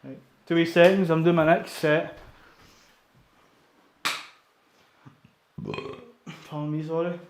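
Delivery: out of breath after a set; breathless, between sets; after a workout. Real, not acted. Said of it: "I'm just catching my breath".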